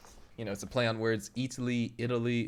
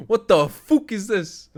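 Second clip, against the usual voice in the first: mock voice; 10 semitones higher, 12 dB louder, slower than usual.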